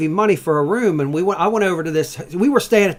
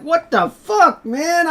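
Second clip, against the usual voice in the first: much higher, louder, slow outraged cry; retold outraged yell; 14 semitones higher, 5 dB louder, much slower than usual. Hungover guest yelling back at motel lady, mock outrage. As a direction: stretch out the indignant protest.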